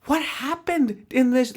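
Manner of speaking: ordinary delivery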